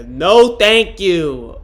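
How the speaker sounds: a loud, appalled no, thank you; loud refusal. Real, not acted. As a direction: blurt it out in disgust. Disgusted refusal of a kiss question.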